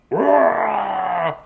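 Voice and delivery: drawn-out, loud playful monster roar. Acted. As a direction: roar like a toy monster come alive. Imitates his toy monster Rodzilla roaring.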